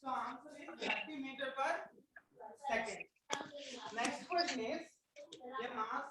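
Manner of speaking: very faint, far from the mic; barely audible. Real, not acted. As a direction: voice fading almost to nothing. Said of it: "Sir, your voice is so low".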